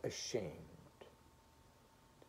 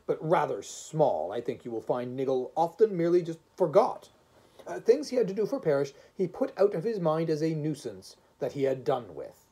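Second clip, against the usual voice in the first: loud, critical judge weighing a case; stern first voice; 4 semitones higher, 7 dB louder than usual. Acted. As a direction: argue the point firmly, louder.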